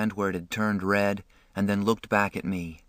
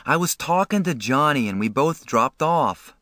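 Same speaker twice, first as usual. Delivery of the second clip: higher-pitched excuse; earnest, pleading explanation; 6 semitones higher, 3 dB louder than usual. A narrator's reading. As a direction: plead your case to an angry brother. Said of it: "I pleaded with Derry".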